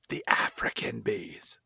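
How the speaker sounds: ominous whisper; hushed dramatic whisper. Retold or imitated. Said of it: "She's like".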